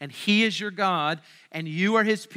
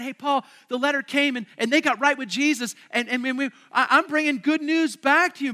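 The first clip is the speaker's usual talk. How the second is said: excited Titus; 6 semitones higher, 6 dB louder than usual. Retold or imitated. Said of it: "he said".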